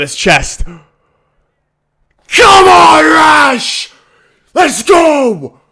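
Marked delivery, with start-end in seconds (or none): 2.4-5.6 higher, louder cheering; real shout. Real, not acted. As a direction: roar the team on. Fan celebrating goal confirmed.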